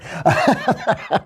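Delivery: high burst of real laughter; amused laugh. Real, not acted. Host laughing after his dramatic Macbeth speech, high giggly laugh. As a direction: crack up at your own performance.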